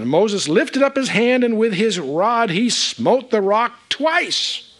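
high, ringing narration slowing to the end; dramatic scripture reading. Real, not acted. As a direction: proclaim the verse, drawing out the close. Reads the verse dramatically.

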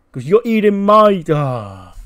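high, very loud accusation; furious acted shout. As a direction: yell it, then break off in exasperation. Acted angry confrontation over food.

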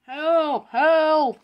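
loud, high repeated cries; screaming for help. Acted. Stranded Oliver heard from the bridge, screaming for help. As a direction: holler for someone to come. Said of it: "he heard Oliver screaming for help".

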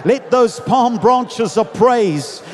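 loud, rousing preacher's delivery; full-force preaching. Acted. Preacher rallies the congregation on the triumphal entry, full-force preaching. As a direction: preach to a big crowd with stretched-out emphasis.

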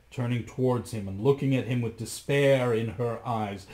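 plain reading voice

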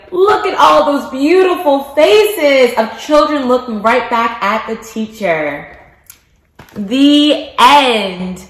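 lilting picture-book narration with big questions; reading to children. Acted. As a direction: read it like circle time for little kids.

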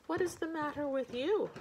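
high-pitched, surprised question; puzzled wife. Acted. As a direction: ask it high, with a swoop up on "you". Wife questions husband lying down.

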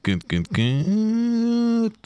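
high, long-held final word; playful checking. Pretend. Streamer asks chat if all is good, playful high drawn-out squeal. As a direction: stretch it out way up high.

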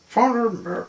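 high, loud storybook call; called-out command. A narrator's reading. Mister Hooper tells Miserable to follow, high loud call.

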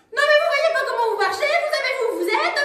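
high, fast scolding voice of an angry passer-by; angry stranger. Acted. A stranger scolds Cali for bumping him, high and fast. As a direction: snap at her for not looking. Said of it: "commence à se mettre dans une colère".

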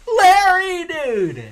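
very high, very loud whoop; ecstatic shout. Real, not acted. Gamer thrilled to find Larry, ecstatic shout. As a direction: scream the name in delight.

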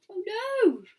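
high, loud "oh no"; dismayed cry. Acted. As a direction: exclaim in alarm at being found. Sneeze gave them away.

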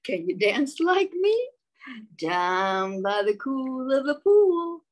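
loud high whoop easing into slow sing-song; gleeful frog calling out; frog shouting whee. Acted. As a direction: cheer like an overjoyed frog. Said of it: "Frog cried".

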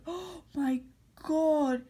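gasp, then a drawn-out exclamation; startled, delighted discovery; surprised gasp. Real, not acted. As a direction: react with real astonishment. Gasps at finding a movie.

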